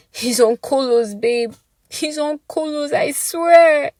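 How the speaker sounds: high crying plea; tearful pleading. Acted. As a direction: beg him through tears.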